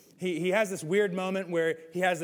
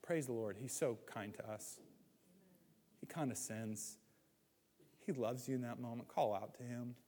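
much softer, hushed praise; soft reverent aside; 7 semitones lower, 13 dB quieter than usual. Acted. The pastor softly reminds the congregation of God's kindness, hushed reverent tone. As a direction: drop to a gentle worshipful hush.

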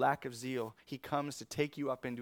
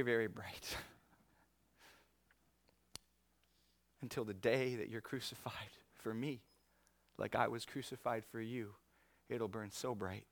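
hushed Jesus; 2 semitones lower, 4 dB quieter than usual. Acted. Jesus promising Peter his crucifixion: hushed whisper. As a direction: lean in and breathe the promise.

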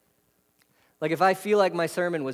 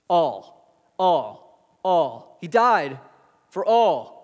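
all repeated, growing louder to for all; building emphasis on all; 5 dB louder, much slower than usual. Shown as a demonstration. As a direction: hammer all each time louder. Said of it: "repeated all, building emphasis".

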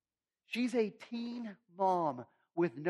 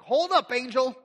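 loud, high Hold up, angel; startled protest; 6 semitones higher, 7 dB louder than usual. Acted. Mary startled by the angel, loud protest. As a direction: stop the angel mid-sentence in shock.

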